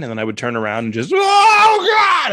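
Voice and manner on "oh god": very high, loud mock scream; agonized scream. Turning around and landing in hell, screaming. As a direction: scream it long, at the top of the voice. Acting his plunge into hell.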